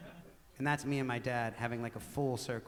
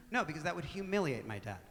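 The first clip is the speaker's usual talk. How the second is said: usual speaking voice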